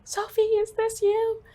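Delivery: high, loud, drawn-out question; frantic disbelief. Retold or imitated. Mother on the phone to her daughter, frantic disbelief. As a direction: cry it out high, slowly, disbelieving.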